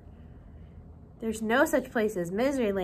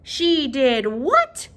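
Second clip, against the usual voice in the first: shocked cry; 7 dB louder, much slower than usual. Acted. The king hearing of Little Miss Sunshine, shocked cry. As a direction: stretch it out in royal shock. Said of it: "cried the king".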